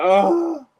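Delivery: high, loud mock groan; hammed-up anguished ugh; disgusted groan. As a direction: melodramatic wail of dismay.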